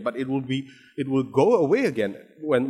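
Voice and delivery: ordinary talk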